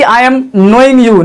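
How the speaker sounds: very slow, each word apart; slow wrong example. Shown as a demonstration. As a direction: lay out the wrong sentence slowly. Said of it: "तो ऐसा नहीं कि". (धीरे-धीरे बोला गया गलत वाक्य)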